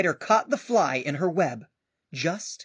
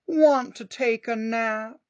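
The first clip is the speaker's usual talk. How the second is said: slow cat voice; 7 semitones higher, 5 dB louder, slower than usual. Acted. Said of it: "cried the cat".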